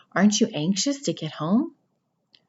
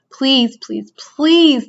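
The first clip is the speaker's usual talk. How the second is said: desperate pleading; 7 semitones higher, 8 dB louder, much slower than usual. Acted. Wilbur begging the rat.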